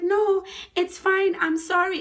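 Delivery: very high, loud pleading; acted panicked whine, stretched out; scared apology. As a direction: beg to be let off. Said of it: "My friend was so scared".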